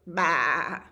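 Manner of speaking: loud, drawn-out baa; goat bleat. Acted. Reader voices the goat, loud bleat.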